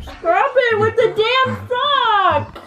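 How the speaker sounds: high, slow laughing protest; half laughing, half crying out; laughing outburst. Real, not acted. Disgusted outburst at sock flavour.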